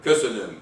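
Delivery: "köszönöm" with a strong KÖ, falling away after; first-syllable stress. Shown as a demonstration. Lecturer giving Hungarian thank you, first-syllable stress. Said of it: "Stress goes on the first syllable".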